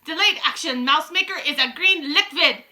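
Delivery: put-on loud, high, commanding witch with a foreign accent; Grand High Witch. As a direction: proclaim it like a grand evil queen. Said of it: "explained the Grand High Witch".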